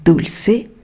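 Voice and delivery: ordinary delivery, nothing marked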